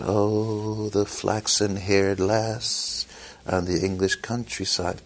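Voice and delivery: slow, soft, hammed-up soppy ballad voice; mock a dreamy folk singer. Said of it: "and sing about".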